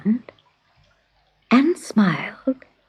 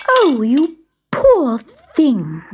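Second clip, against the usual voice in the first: higher, drawn-out pity; sympathetic cry; 4 semitones higher, 3 dB louder, much slower than usual. A narrator's reading. Fairy finding a dog with cans on its tail, pitying cry. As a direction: exclaim slowly with heartfelt sympathy. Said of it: "Larkspur cried".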